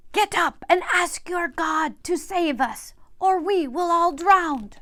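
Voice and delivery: loud, high, urgent call over the storm; captain's urgent shout. A narrator's reading. Captain shouting at sleeping Jonah to pray.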